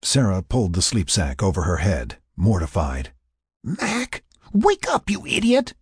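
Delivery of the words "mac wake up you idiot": high-pitched, exasperated hiss to wake him; urgent scolding. A narrator's reading. Sarah urgently waking Mac.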